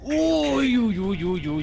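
nervous cries as they slide; alarmed. Real, not acted. Slipping on the climb.

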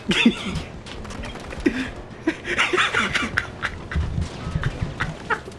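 breathless wheezing laugh. Real, not acted. Breathless laugh after fight.

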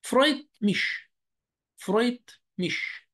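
فرويت مش said slowly as German freut mich; German word demo. Shown as a demonstration. Teacher modeling freut mich slowly for students. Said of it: "كلمة فرويت مش بمعنى يسعدني".

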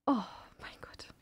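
frightened breathy whisper. Real, not acted. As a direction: whisper in shock. (erschrocken geflüstert)

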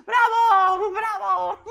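very high laughing bravo shouts; mock cheering. Real, not acted. Host mockingly applauding his own little speech, high laughing shouts. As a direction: cheer yourself like a crowd.

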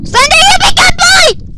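high, loud mock pet-praise; loud coaxing praise. Real, not acted. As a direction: shout praise at Slenderman like a dog. Coaxing the monster.

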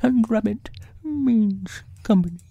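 high, simple bear voice; musing storybook bear. Acted. Bear working out what the hole means, high character voice. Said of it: "he said".

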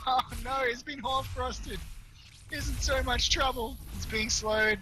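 high, urgent play-by-play cry; rising panic over the fight; excited alarm. Real, not acted. Excited call, tense moment.

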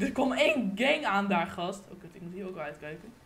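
normal talking voice

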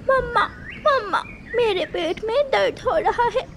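put-on high childlike voice, starting to whimper; a small bird chick begging her mother; little girl in pain. A narrator's reading. Young chick tells mother her tummy hurts, whimpering. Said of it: "ऐसा कहते हुए मुन्नी रोने लग जाती है".

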